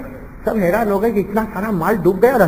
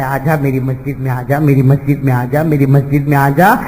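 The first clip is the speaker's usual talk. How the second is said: inviting call; 7 semitones lower than usual. Retold or imitated. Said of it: "اسی لیے کہتا ہے".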